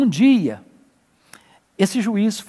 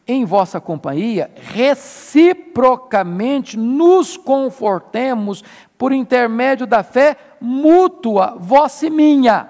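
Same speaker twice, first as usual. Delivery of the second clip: slow, louder, rising reading of the verse; slow, emphatic Bible reading, much louder; solemn scripture reading; 5 semitones higher, 5 dB louder, much slower than usual. Shown as a demonstration. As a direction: declaim the text slowly and strongly.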